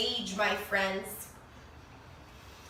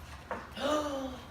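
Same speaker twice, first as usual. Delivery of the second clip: soft gasp; 7 dB quieter than usual. Acted. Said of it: "reader gasps before finding out".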